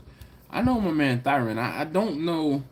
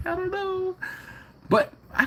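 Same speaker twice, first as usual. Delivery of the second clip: acted hesitant reply. Husband replaying his answer to wife, high mock uncertainty. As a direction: sing it up high, acting undecided.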